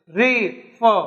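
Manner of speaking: "re ... for" in two separate beats; split into syllables. Shown as a demonstration. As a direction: break "re for" with a clear pause between syllables. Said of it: "two syllables".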